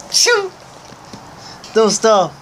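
squealing. Real, not acted. Squealing while tickled.

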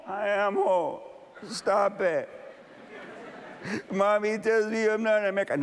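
put-on high child's voice, pleading; a small boy protesting through fear; little boy answering the bullies, high and pleading.

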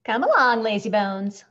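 loud, lifted rousing call; chivvying nurse. A narrator's reading. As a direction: hurry a sleeper out of bed. Nurse rouses sleepy patient.

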